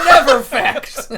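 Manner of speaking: high, slow, laughing jab; laughing retort. Real, not acted. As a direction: laugh out the punchline.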